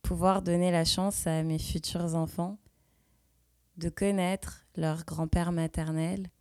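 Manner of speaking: ordinary delivery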